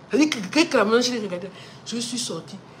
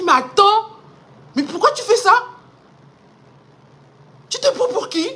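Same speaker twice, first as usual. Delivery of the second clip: high, loud furious challenge; angry retort; 11 semitones higher, 9 dB louder than usual. Retold or imitated. Daughter shouting back at her president father, furious. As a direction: confront the president in a rage. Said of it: "j'étais fâché".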